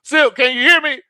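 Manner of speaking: loud, high mock holler; calling out. Host to Silk on the roof, hollering. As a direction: shout up to someone on the roof.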